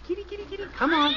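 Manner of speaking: very high sing-song kitty call; coaxing a cat. Acted. Dad in the rafters calling the cat, very high sing-song.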